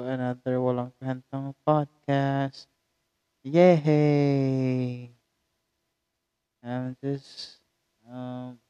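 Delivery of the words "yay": mock cheer. Real, not acted. Vlogger greeting listeners with an ironic cheer. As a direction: cheer it with a wink.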